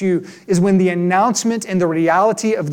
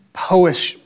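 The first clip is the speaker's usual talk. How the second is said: ordinary talk, nothing marked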